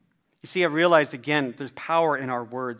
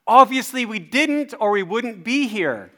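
loud, high, drawn-out sarcasm; indignant retort; 8 semitones higher, 8 dB louder, slower than usual. Retold or imitated. Dad snapping back at the construction man, indignant. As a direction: fire back slowly with heavy exasperation.

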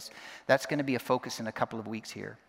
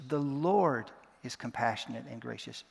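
weighty, lengthened "Lord"; stress on LORD. Shown as a demonstration. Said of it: "putting emphasis on one word at a time".

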